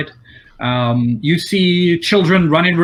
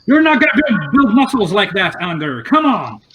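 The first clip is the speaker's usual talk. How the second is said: teasing shout; 7 semitones higher, 5 dB louder than usual. Acted. Shopkeeper teases the struggling kid.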